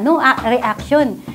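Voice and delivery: ordinary delivery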